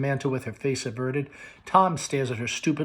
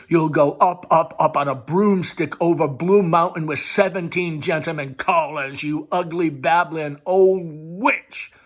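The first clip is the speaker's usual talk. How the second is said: loud, rising, slowing on the insult; cruel sarcasm; 3 semitones higher, 7 dB louder than usual. Acted. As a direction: spit the last words out.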